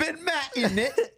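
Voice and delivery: put-on high, slow, laughing London accent; hammed-up roadman voice, drawn out; British slang accent. Echoes the British slang phrase.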